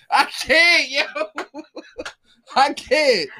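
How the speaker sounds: squeaky breathless laughter through words; can't stop laughing; laughing fit. Real, not acted. Cracking up at the moaning story, laughing hard.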